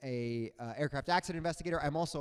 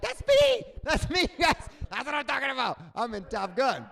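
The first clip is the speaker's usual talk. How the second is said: very high, loud excited cheer; thrilled; 8 semitones higher, 7 dB louder than usual. Retold or imitated. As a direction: cheer like you just got famous. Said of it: "That's what I'm like".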